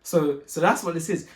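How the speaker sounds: usual speaking voice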